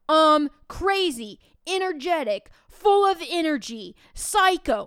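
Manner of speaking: put-on high, loud kids blurting out guesses; excited guesses. Kids yelling guesses at the teacher, excited and loud. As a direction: shout out answers like eager kids.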